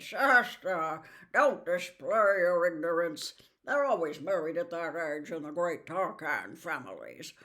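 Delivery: put-on a grand old warhorse putting a boy in his place; Bree the proud horse, condescending. Bree the horse scolds Shasta.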